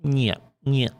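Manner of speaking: "Niet" snapped out high in a mock Russian voice. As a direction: imitate Russians repeating "Niet". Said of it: "always saying something like".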